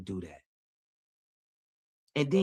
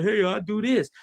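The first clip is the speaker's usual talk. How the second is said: higher, louder mock boast; mock bragging; 6 semitones higher, 5 dB louder than usual. The showy giver announcing himself, higher and louder bragging. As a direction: show off your good deed. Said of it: "to get show and say".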